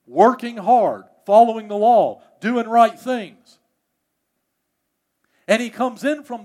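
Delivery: loud, high-pitched sermon storytelling; impassioned preaching. Acted. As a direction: preach the homecoming with rising excitement. Preaches story at full force.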